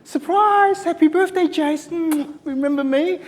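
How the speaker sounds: spring it on him like an unwanted surprise; psycho ex-girlfriend. Retold or imitated. Clingy ex-girlfriend ambushing Jason on his birthday, high loud mock voice. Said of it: "my psycho ex-girlfriend who wouldn't leave me alone".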